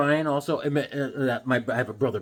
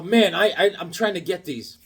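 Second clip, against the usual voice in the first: ordinary delivery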